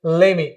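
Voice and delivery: "Let me" joined as lemme; silent T. Shown as a demonstration. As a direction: drop the T in "Let me". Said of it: "टी totally silent हो जाएगा".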